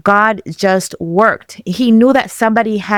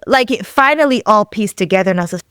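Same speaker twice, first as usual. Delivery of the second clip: usual speaking voice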